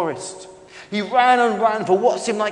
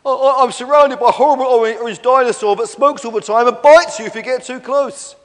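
high, loud, stammering wail; hammed-up sobbing; 6 semitones higher, 10 dB louder than usual. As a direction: wail out your troubles, stammering. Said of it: "sobbed Stefan".

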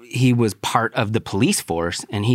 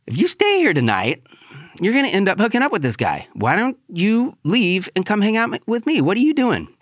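much higher, fast, urgent pleading; frantic pleading; 11 semitones higher than usual. Retold or imitated. Warning her she'll hook up with him, frantic plea. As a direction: warn her, pitched up and rushing. Urging her to leave guy.

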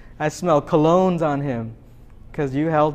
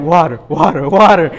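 louder, coaxing repeat of "water"; a half-joking push toward the healthy choice; nudging hint; 12 dB louder than usual. Retold or imitated. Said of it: "Trying to suggest".

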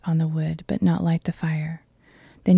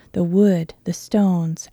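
usual speaking voice

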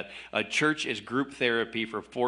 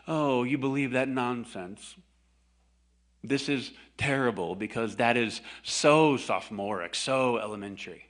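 condescending British sneer; mock British accent. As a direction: look down your nose in a British accent. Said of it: "I think a British accent".

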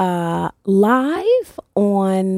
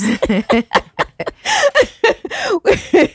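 high burst of laughter; 10 semitones higher than usual. Real, not acted. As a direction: break into a big laugh. Host laughs at own joke.

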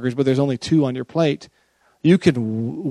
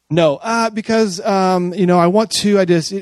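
flustered excuse; 5 semitones higher, 4 dB louder than usual. Retold or imitated. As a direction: fumble for a reason. Acted scrambling justification.

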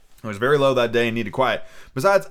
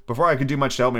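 ordinary delivery

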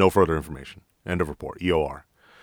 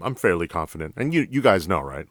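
ordinary delivery

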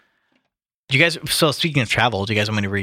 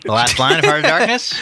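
mock disbelief; 6 semitones higher, 5 dB louder than usual. Real, not acted. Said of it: "I laughs".

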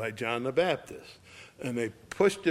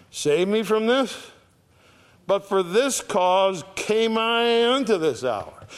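raised, high preaching voice for an acted prayer; impassioned declaration; 8 semitones higher, 5 dB louder than usual. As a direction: proclaim the quote high and forcefully. Jesus's prayer acted out at full force.

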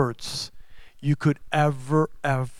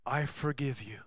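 much softer, gentle; tender forgiveness; 10 dB quieter than usual. Retold or imitated. Scene: Jesus to the sick man, soft tender forgiveness. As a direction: forgive him quietly and kindly. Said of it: "he said to him".

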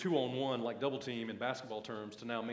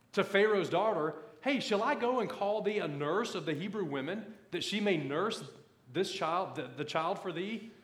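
raised, emphatic preacher's Bible reading; scripture reading; 5 semitones higher, 5 dB louder than usual. Acted. Preacher reads the story of Moses to his church, emphatic scripture reading. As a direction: read the verse out to a congregation with punch.

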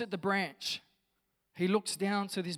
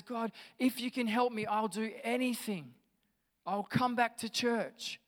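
higher-pitched, desperate pleading promises; calling out, bargaining; 5 semitones higher, 4 dB louder than usual. Acted. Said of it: "Joe calls out and says".

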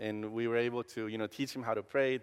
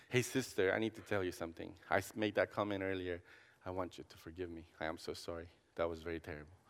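soft apology; 5 semitones lower, 8 dB quieter than usual. Retold or imitated. Retold apology to his sister.

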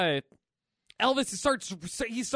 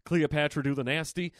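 a hint of old [name], sly; lightly voiced [name]; 7 semitones lower than usual. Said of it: "he's like".

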